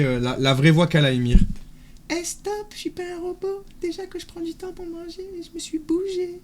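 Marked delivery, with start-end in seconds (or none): 2.1-6.4 put-on tiny, very high anime-dub girl voice; imitating Ymir's voice. Streamer imitates a friend for viewers, high cartoon-girl voice. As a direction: squeak it softly like a French anime dubber. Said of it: "je vais vous imiter la voix qu'elle fait".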